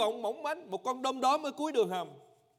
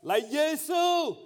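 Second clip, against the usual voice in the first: crying out for mercy; 6 semitones higher, 6 dB louder than usual. Acted. Lepers calling to Jesus from afar, loud sung plea. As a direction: cry out from far away, begging. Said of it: "lên tiếng kêu rằng".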